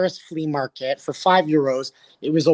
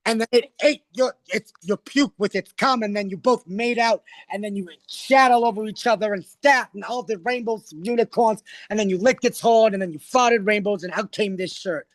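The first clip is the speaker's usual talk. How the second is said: higher-pitched roasting comedienne; Joan Rivers impression; 4 semitones higher than usual. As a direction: rattle off a mock read, honey first. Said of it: "Joan was like".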